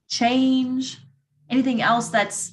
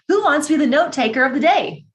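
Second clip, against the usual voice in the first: higher, louder call to the group; 6 semitones higher, 5 dB louder than usual. Real, not acted. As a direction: call out to everyone. Calling for a volunteer.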